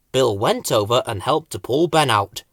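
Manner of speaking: ordinary delivery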